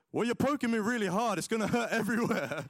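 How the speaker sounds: high, loud, laughing protest. Retold or imitated. Teen laughing at dad for poking too hard. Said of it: "And I'm like".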